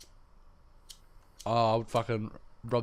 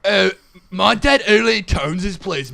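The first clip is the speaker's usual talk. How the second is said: higher, louder cartoonish American voice; mock American accent; 6 semitones higher, 10 dB louder than usual. Shown as a demonstration. Still doing the American accent for his friend, cartoonish. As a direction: play a spoiled cartoon kid. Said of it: "You sound like a character off of the Fairly Odd Parents".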